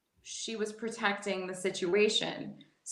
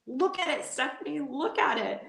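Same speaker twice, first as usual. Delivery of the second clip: high-pitched mock yell without much volume; higher, insistent mock command; retold mom's yell; 6 semitones higher than usual. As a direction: imitate an urgent mother, pitch raised. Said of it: "my mom was yelling at me".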